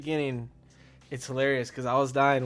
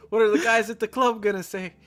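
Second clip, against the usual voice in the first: mock panic; 9 semitones higher, 6 dB louder than usual. Retold panicked reaction.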